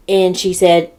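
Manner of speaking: normal talking voice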